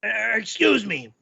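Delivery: normal talking voice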